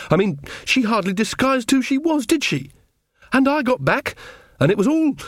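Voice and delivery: high-pitched, aggrieved outpouring; indignant and wound up; upset protest. Acted. Upset at being manipulated.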